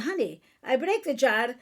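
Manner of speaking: evenly voiced wife in Chaldean accent; calmer appeal. Mother modelling a wife telling her husband, calmer appeal. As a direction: imitate telling a husband plainly, without snapping. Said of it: "respond better to calmness than to tone".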